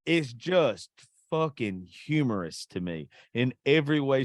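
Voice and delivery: ordinary talk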